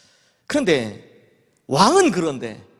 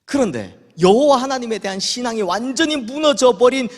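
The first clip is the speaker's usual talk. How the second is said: normal talking voice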